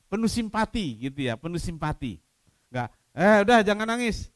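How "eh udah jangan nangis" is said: brisk, careless comfort, louder; offhand shushing, no real sympathy; brushing off. Shown as a demonstration. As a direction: toss it off like a quick dismissal. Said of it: "Enggak lah".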